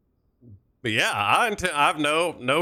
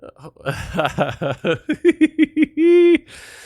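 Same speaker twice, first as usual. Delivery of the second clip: big laugh; 13 semitones higher, 8 dB louder than usual. Real, not acted. Laughing at dad joke.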